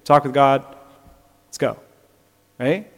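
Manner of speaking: usual speaking voice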